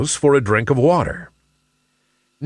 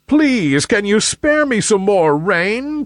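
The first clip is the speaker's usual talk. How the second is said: higher-pitched imploring request; pleading; 10 semitones higher than usual. A narrator's reading. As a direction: beg for just a bit more. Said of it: "pleaded the sun".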